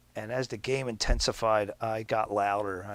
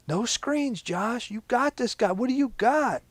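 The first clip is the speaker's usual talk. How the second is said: passionate cheering; 14 semitones higher, 6 dB louder than usual. Retold or imitated. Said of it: "That's how passionate T J is".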